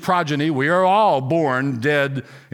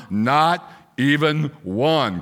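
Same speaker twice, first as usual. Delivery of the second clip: slow emphatic reading; much slower than usual. Acted. Preacher stressing scripture.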